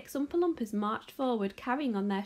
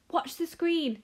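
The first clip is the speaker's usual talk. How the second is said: crying out; 6 semitones higher, 3 dB louder, slower than usual. A narrator's reading. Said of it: "he cried".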